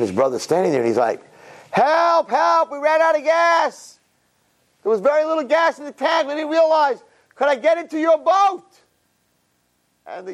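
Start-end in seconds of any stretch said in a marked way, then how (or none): 1.7-8.6 high, desperate cry for help; panicked plea. Acted. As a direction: beg from the stuck yacht. Stranded brother calls for help.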